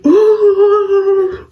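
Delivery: high drawn-out ooh; crowd ooh. Retold or imitated. Classmates reacting to the insult, high drawn ooh. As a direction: imitate the whole class reacting.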